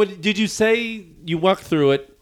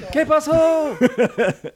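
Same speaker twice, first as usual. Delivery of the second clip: put-on slow, high, laughing Spanish accent; mock Spanish; 12 semitones higher, much slower than usual. Friend voicing the fleeing workers, mock Spanish accent. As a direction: drawl it like a confused worker, in Spanish.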